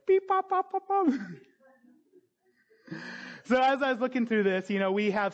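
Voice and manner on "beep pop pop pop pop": very high, loud beeps and pops; high silly noises. Retold or imitated. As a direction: squeak it like a toy. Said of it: "It was like".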